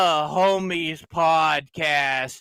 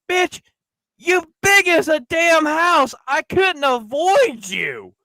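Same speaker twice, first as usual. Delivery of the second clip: retold yell; 10 semitones higher, slower than usual. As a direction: yell it back at her, pitched up. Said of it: "And I'm like".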